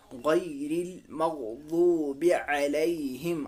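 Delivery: slow, elongated tajweed recitation; Quran recitation. Shown as a demonstration. Teacher recites the phrase for learners, slow recitation. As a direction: recite slowly, stretching each long vowel.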